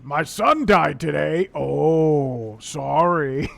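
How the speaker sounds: deadpan joke in a silly voice; mock character bit. Friends goofing in voices, lightly put-on deadpan. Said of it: "Cindy and I will literally just".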